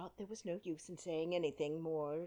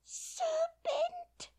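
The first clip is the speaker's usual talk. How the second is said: sharp, high whispered shriek; scream; 7 dB louder than usual. A narrator's reading. Pigeon screams "Serpent!" at Alice. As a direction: spit "Serpent!" high and breathy as a screech. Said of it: "screamed the pigeon".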